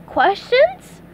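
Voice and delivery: SpongeBob voice. Acted. SpongeBob to audience.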